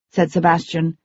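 plain reading voice

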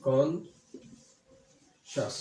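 Conscious dragged out extremely slowly, কন-শাস; syllable by syllable. Shown as a demonstration. Teacher spelling out conscious by sound, extremely slow. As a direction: hold each syllable of conscious long. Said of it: "আমার ঠোঁট কিন্তু দুইবার লড়তেছে".

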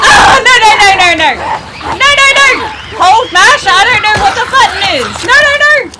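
high frantic shouts of no; panicked screaming. Real, not acted. Player panicking under attack.

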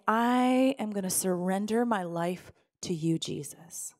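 soft surrender. Pretend. Believer with arms open surrendering to Jesus, soft fading prayer. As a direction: let go gently, almost breathing it.

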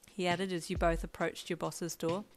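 ordinary delivery

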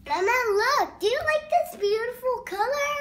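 excited. Real, not acted. Child shows mother rainbow.

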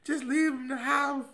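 high-pitched imitation of his mother; mother's advice. Son imitating his mother urging him to give up the house. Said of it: "my mother's like".

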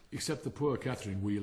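ordinary delivery, nothing marked

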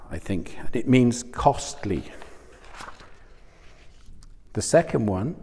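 ordinary talk

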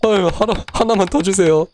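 high, cutesy begging; pleading. Real, not acted. Begging for more food. (귀엽게 하나 더 달라고 조르기)

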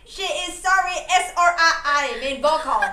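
loud self-introduction. Real, not acted. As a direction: project it loud to the fans. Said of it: "너 목소리가 너무 커서".

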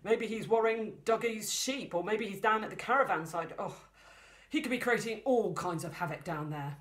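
a dad working himself up about the dog; worried dad. Acted. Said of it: "said the dad".

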